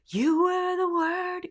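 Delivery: "You" sung breathy with a W, slow and high; breathy bad habit. Shown as a demonstration. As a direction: sing it the weak airy way. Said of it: "assume everybody's worst habits".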